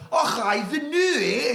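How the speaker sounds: high, loud bad Scottish accent, Americans mocked. Scot mocking Americans' bad Scottish accent, high and loud. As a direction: ham up a terrible Scottish accent. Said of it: "thinks they can do a good Scottish accent".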